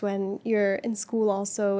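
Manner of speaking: usual speaking voice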